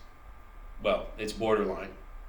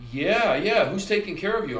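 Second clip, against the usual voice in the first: much higher and louder, quick yeah-yeah; eager nurse voice; 8 semitones higher, 5 dB louder than usual. Retold or imitated. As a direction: chirp over him like a pushy nurse. Nurse brushes him off.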